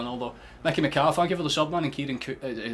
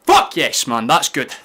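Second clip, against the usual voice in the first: loud burst of relief and triumph; celebratory shout; 11 dB louder, slower than usual. Real, not acted. Streamer celebrating a late winner, loud triumphant shout.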